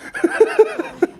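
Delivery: a short, loud, high laugh; burst of laughter. Real, not acted.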